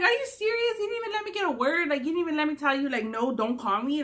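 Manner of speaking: high-pitched "are you serious" rant at the guy who hung up; outraged disbelief. Retold or imitated. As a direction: fire it back at him, incredulous. Acted outraged quote to him.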